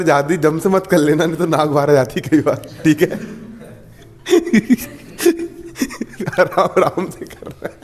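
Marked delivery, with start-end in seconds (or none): 4.3-4.9 a real giggle breaking through; high-pitched laugh escaping; sudden high laugh. Real, not acted. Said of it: "हंसी आ गई एकदम से". 6.4-7.8 laughing. Real, not acted. Teacher giggling mid-lesson.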